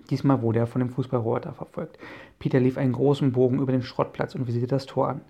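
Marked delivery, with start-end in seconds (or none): none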